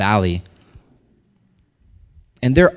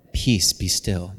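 low, slow, gentle intoning; softly pious, understated parody; meek, serene command; 4 semitones lower, 3 dB quieter, slower than usual. Acted. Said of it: "if you wanna say".

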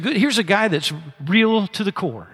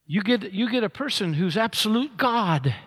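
ordinary talk, nothing marked

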